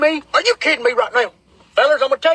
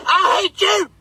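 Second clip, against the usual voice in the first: higher-pitched mock scream; high mock yell of accusation; retold scream; 7 semitones higher, slower than usual. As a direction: blurt it out high, imitating her scream. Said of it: "I hear her scream".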